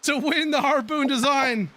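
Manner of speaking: brisk race call building toward the finish; racing commentator calling the last laps; live play-by-play. Acted. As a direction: call the pack as it jostles for position.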